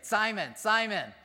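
calling out Simon twice, loud and high. Acted. Jesus calling Simon sharply to get his attention. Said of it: "here's Jesus saying".

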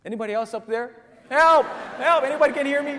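high, loud comic cry for another rescuer; rising into desperate shouts; yelling for help. Acted. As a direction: call out past God, then holler. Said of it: "yell out at the top of his lungs".

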